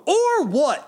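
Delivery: high, drawn-out mock challenge; childish defiance. Answering a reminder at work, childishly defiant. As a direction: throw it back high and stretched.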